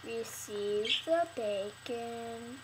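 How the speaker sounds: slow deadpan. Pretend. Slow mock command.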